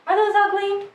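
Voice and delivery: high, incredulous gasp of a question; recoiling at the sight of ugly shoes; mock shocked surprise. First seeing the sandals, mock shocked high question. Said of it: "I was like".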